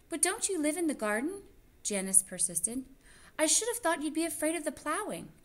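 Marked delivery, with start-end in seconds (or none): 0.1-1.4 put-on high child voice. Janice questions Mrs Frisbee. 3.4-5.3 put-on high child voice. Janice questions Mrs Frisbee.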